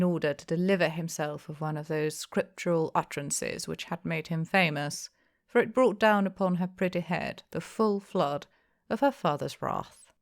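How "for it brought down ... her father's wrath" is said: ordinary delivery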